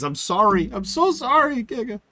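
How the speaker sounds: high, loud, hammed-up apology; mock over-apology. Streamer blaming Canadian disease for apologizing to Giga, mock over-apology. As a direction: apologize way too much, pitch climbing very high.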